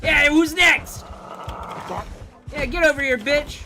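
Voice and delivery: high, loud fighting yell; defiant battle shout. Real, not acted. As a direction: roar at the horde. Fighting zombies, yelling.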